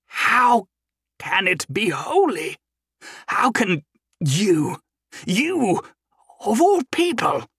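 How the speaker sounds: high, slow, disbelieving cry; shocked disbelief. Acted. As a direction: plead in stunned disbelief.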